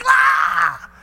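high, loud startled yelp; startled scream. Retold or imitated. Terrified boy home alone screams at the ringing phone, loud and high. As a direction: shriek as the phone rings. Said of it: "And I'm like".

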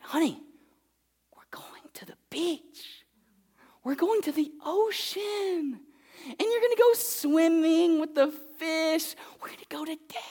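dad whispering the surprise to his daughter; hushed secret. Retold or imitated. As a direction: whisper it like a treat to a toddler.

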